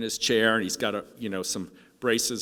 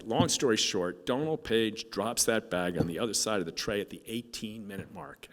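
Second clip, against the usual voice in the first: normal talking voice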